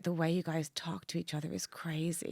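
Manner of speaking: whispered, half-voiced disbelief; hushed aside. Imitating an American runner whispering to her about Brits. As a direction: lean in and murmur it in disbelief. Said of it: "he's like".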